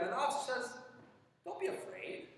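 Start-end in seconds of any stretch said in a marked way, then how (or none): 1.4-2.3 breathy whispered reassurance, high and hushed; soft whisper. Acted. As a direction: whisper it gently to calm him. Officer reassuring the frightened man.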